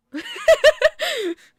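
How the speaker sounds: shrieking laugh at her own joke; loud high laugh. Real, not acted.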